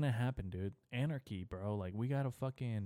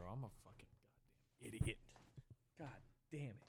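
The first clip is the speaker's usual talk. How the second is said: hushed self-reproach under his breath; whispered self-scolding; 2 semitones higher, 11 dB quieter, much faster than usual. Real, not acted. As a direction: whisper it to yourself, annoyed at your own mistake. Muttering at himself after a slip.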